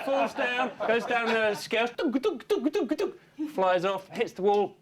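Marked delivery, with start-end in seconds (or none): 1.9-3.1 high, rhythmic "dunk" tumbling noise; bumping sound effect. Acted. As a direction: bounce each "dunk" like a cartoon fall. Imitates tumbling down the scales.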